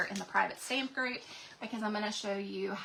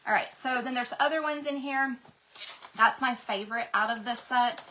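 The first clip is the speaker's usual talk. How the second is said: ordinary talk, nothing marked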